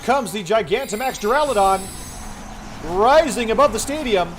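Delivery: announcer voice. Acted. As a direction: call it like a stadium battle commentator. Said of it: "this announcer voice".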